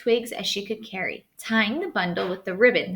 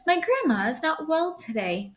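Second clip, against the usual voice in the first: put-on little girl voice; 8 semitones higher than usual. Girl answers wolf.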